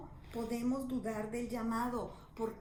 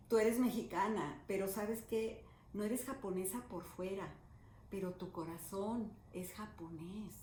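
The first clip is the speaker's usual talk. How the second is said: progressively softer, tender quote; gentle retold reassurance; 2 semitones lower, 5 dB quieter than usual. Japanese woman tells the doubting Mexican missionary about her heart, softly and tenderly. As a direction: let the stranger's words grow quieter, sinking gently to the end.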